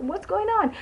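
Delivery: high, puzzled what's going on; surprised question. Retold or imitated. Noticing fellow passengers taking photos, surprised. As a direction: ask it in sudden confusion. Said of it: "I was like".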